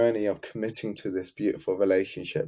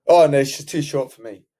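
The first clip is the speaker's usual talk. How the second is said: loud scoffing no; dismissive refusal; 4 semitones higher, 10 dB louder than usual. Retold or imitated. Client loudly rejects the woman coach suggested. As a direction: brush her off loudly. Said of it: "I goes".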